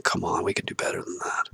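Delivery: breathy unvoiced whisper of frustration; whispered plea. Real, not acted. Speaking to himself about doing better, in a whisper. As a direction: hush it out like a private plea.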